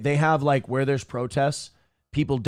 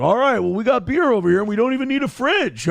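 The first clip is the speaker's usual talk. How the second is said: higher and louder, a cheerful bro boast; upbeat bragging beer guy, higher and louder; jovial bragging guy; 8 semitones higher, 4 dB louder than usual. Retold or imitated. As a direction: play the happy guy handing out beers. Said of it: "He's like".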